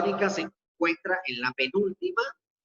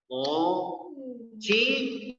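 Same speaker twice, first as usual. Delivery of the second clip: "Mochila" very slow, with CHI held long and higher; syllable by syllable; 6 semitones higher, much slower than usual. Shown as a demonstration. Teacher helps pupil hear the tonic syllable, "Mochila" split slowly. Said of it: "vamos a separarla".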